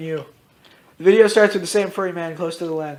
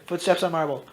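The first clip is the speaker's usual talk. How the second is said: plain reading voice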